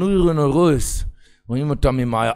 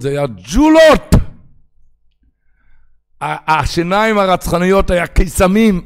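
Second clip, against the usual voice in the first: triumphant; 6 semitones higher, 8 dB louder than usual. Acted. Reveal of marbles.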